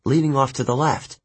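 ordinary delivery, nothing marked

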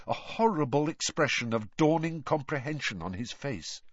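plain reading voice

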